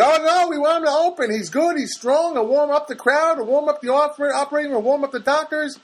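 high-pitched, loud rebuttal; mock doctor in a bidding war; doctor arguing back. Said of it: "and then the doctor goes".

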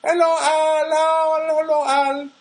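high, loud greeting in a bad Filipino accent; hammed-up Filipino accent, calling out hello; excited mock Filipino greeting; [name], terrible Filipino accent. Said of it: "terrible Filipino accent".

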